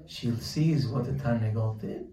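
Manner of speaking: ordinary delivery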